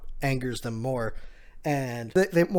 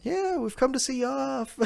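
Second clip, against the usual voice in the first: very high put-on voice; high cheery call; 12 semitones higher than usual. Yamato atop Momo seeing them off, high mock call.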